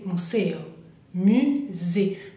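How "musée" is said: "musée" slowly, the s buzzed as z; careful French "musée" with a z sound; French pronunciation model. Shown as a demonstration. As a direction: model "musée" as mu-zé, syllables apart. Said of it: "la S es como una Z".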